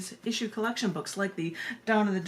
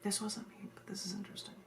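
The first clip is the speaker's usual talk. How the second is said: whispered aside; 12 dB quieter than usual. Real, not acted. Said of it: "whispered aside before poem".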